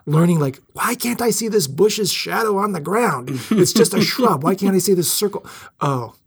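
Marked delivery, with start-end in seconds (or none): 0.7-5.4 confused self quote. Retold or imitated. Retelling his confused self.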